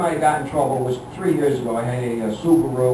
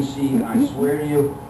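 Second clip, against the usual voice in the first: usual speaking voice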